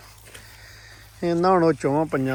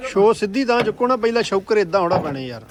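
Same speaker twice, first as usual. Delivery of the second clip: calling out; 4 semitones higher, 5 dB louder than usual. Real, not acted. Directing workers in field.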